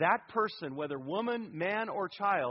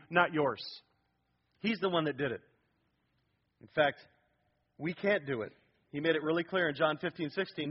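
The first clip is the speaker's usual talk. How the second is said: ordinary talk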